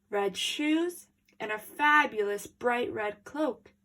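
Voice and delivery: plain reading voice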